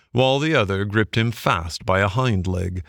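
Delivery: ordinary delivery, nothing marked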